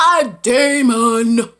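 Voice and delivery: loud, very slow, stretched demon; drawn-out proclamation. Retold or imitated. Viewer naming the monster to herself, drawn-out proclamation. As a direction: boom it out slowly, stretching each sound. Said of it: "But I said".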